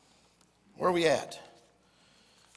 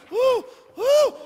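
high, short hooted woos; novice's holler "woo"; 10 dB louder than usual. Acted. City slicker told to holler, short high woos. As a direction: hoot it, trying to holler. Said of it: "He went".

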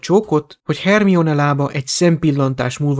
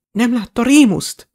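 high, urgent raised voice; calling after; 9 semitones higher, 4 dB louder than usual. A narrator's reading. Tonks calls after Aberforth about Remus, urgent shout. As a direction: call an urgent question after someone running. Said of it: "kiáltott utána Tonks".

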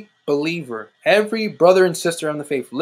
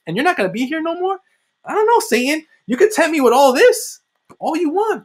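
taunting Satan; 10 semitones higher than usual. Acted.

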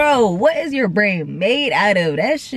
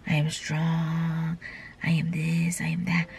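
recited affirmation; 7 semitones lower, 8 dB quieter than usual. Retold or imitated. Reciting affirmations in the mirror, quiet and low.

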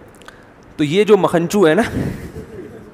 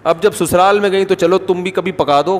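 ordinary talk, nothing marked

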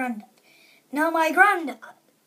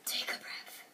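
gasp; 15 dB quieter than usual. Pretend. Gasp and whispered line.

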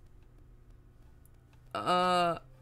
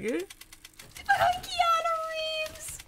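fan squeal; much slower than usual. Real, not acted. Fan thrilled about Sonic three casting, high giddy squeal. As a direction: squeal the actor's name.

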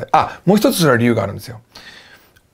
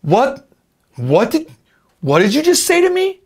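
high, slow, incredulous challenge; acted movie confrontation; 10 semitones higher, 3 dB louder, much slower than usual. Teacher demonstrates lines for students to copy, indignant and incredulous. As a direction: escalate from disbelief to an indignant challenge. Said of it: "真似してください".

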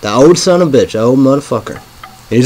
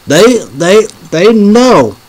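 heated outburst; 6 semitones higher than usual. Real, not acted. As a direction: spit out the word evil with contempt. Ranting about the old leader.

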